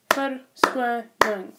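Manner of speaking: "verskoning" very slowly, syllable by syllable; "verskoning" with clear gaps between syllables; broken into syllables. Shown as a demonstration. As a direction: split "verskoning" into separate beats. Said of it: "words that have at least two syllables".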